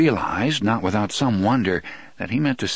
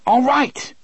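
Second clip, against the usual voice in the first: high, exasperated yell; crying out; 4 dB louder than usual. A narrator's reading. Eddie giving in to Roland, exasperated cry. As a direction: cry it out in angry surrender. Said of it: "Eddie cried".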